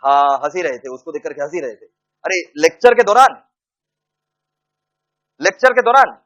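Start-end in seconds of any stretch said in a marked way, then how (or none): 2.3-6.2 louder, higher scolding. Real, not acted. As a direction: stress on दौरान, raised voice. Teacher scolding class.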